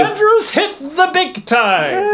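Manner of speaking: very high, squeaky put-on voice; mock vocal overdub. Shown as a demonstration. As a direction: draw it out in a silly falsetto. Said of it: "Did I say it like this?".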